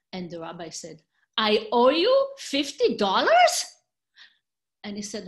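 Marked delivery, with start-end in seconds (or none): 1.4-3.5 slow, loud, much higher disbelieving question; shocked surprise. Acted. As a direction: stretch it out in loud astonishment. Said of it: "why are you surprised?".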